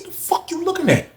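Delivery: high but soft challenge; not even yelling. Retold or imitated. To the staring dude, a high, soft, unyelled challenge. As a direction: confront him without raising the volume. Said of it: "I ain't even yelling".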